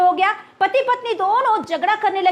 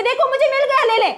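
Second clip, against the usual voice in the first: higher, pleased mock discovery; excited find; 7 semitones higher than usual. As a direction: show the coin with playful delight. Mother showing found coin. (माँ की खुशी भरी आवाज़)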